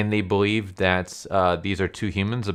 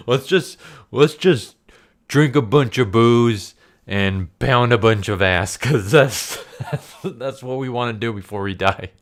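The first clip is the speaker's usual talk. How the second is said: laughing through it; 4 semitones higher, 5 dB louder than usual. Real, not acted. Reviewer quotes the doomed scientists: laughing, louder. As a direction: push the line out while still laughing. Keeps quoting while laughing.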